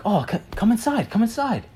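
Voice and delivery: high, eager host voice, lightly done; eager welcome. Retold or imitated.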